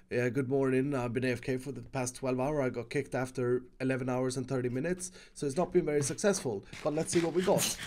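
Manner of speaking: low, soft, just-woken mumble; morning voice. Pretend. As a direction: play a groggy morning-after report, low and soft. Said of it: "with his morning voice".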